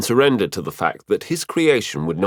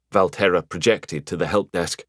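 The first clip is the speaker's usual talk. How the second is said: usual speaking voice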